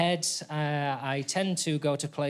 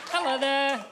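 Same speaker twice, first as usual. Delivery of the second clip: higher hello; 10 semitones higher than usual. Acted. Repeat greeting higher.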